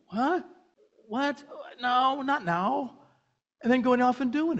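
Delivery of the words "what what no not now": high, slow put-upon refusal; dismissive brush-off. Pretend. Jesus brushing off his mother's request, high and slow. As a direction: wave her off with a bored No, not now. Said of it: "you got Jesus going".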